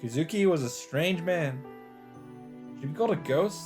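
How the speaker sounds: ordinary delivery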